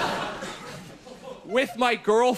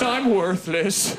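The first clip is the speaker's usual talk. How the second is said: low, slow mock dejection; mock sad man; 4 semitones lower, slower than usual. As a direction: imitate a miserable man, dragging the words. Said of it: "he looked very sad".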